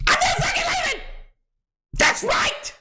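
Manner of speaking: high defiant yell; hyped yelling. Real, not acted. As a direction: shout it with hype.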